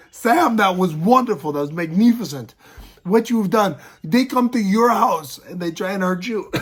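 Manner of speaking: lightly acted, emphatic coach's praise; proud French coach. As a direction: play a French coach bursting with pride. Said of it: "my French coach, he was smiling".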